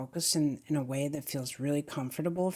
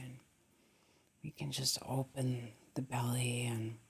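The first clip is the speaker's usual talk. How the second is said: soft, slightly lowered meditation voice; hushed, settled, calming guidance; guided meditation; 3 semitones lower, 4 dB quieter than usual. Acted. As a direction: guide the breath gently, leaving long pauses.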